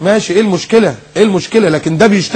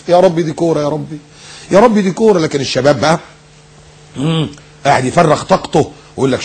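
ordinary talk, nothing marked

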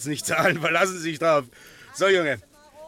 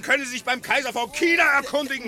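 raised, higher angry rant; angry shouting; 6 semitones higher, 3 dB louder than usual. Acted. Breckinridge throws the nuisance out, fuming and loud. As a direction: drive the pest away in a temper. Dealer chasing off a pestering customer.

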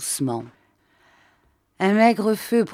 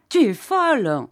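raised, scornful retort; angry husband; 6 semitones higher, 5 dB louder than usual. Acted. Husband scorns his wife: sharp, raised retort. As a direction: snap back at his wife in disbelief.

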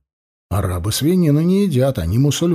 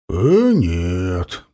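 low tipsy chuckle on the words; drunken laugh; 6 semitones lower than usual. A narrator's reading. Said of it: "пьяно засмеялся Сосновский".

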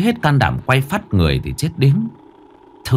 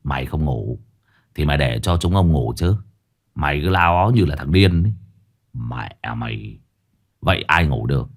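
low, disgruntled muttering; grumbling curses; 7 semitones lower, 3 dB quieter than usual. A narrator's reading. Duy lets go of Minh, grumbling curses. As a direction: grumble it low under your breath. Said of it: "rồi lầu bầu chửi".

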